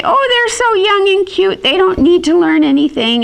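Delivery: high, doting parent voice; indulgent parent. Retold or imitated. Parents cooing over their baby, doting.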